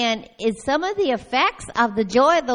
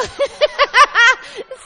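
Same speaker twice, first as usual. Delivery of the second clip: loud high laugh; 13 semitones higher, 7 dB louder than usual. Real, not acted. Telling the church about the arrest, bursting into loud laughter.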